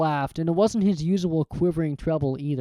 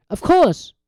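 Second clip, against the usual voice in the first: cool; 12 semitones higher, 7 dB louder than usual. Acted. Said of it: "said Quirrell coolly".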